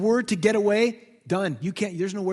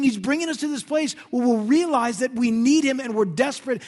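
normal talking voice

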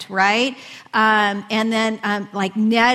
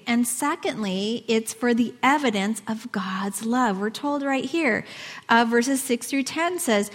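ordinary delivery

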